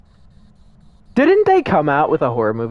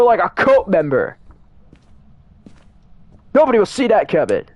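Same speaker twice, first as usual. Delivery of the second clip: eager, higher pitch brainstorm; excited producer pitch; 6 semitones higher than usual. Retold or imitated. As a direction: pitch it like a studio exec. Imitating movie producers pitching.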